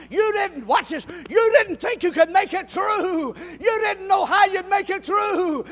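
loud, high, shouted preaching; preaching at full force. Acted.

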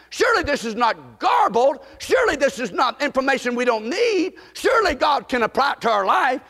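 fiery preacher at full cry; preaching at full force. Real, not acted. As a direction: build the sermon to a passionate call. Rising fervent preaching.

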